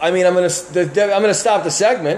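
loud interruption. Real, not acted. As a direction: break in loudly to stop the show.

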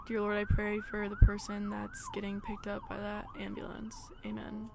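soft, reverent little prayer; hushed prayer. Real, not acted. Vlogger prays for a passing ambulance patient, hushed and reverent. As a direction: pray quietly for the stranger. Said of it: "Say a prayer".

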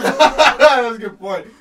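loud, slow, laughing through it. Real, not acted. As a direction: burst out laughing. Cracking up at a friend's joke.

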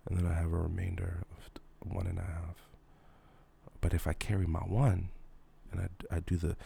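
mock math. Mock calculating the customer's logic.

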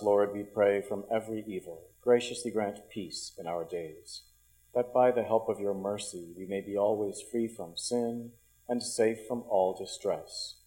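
ordinary talk, nothing marked

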